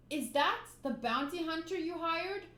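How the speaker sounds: ordinary delivery, nothing marked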